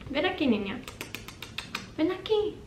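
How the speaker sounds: high-pitched coaxing call; calling a pet. Real, not acted. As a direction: coax a pet over in a high voice.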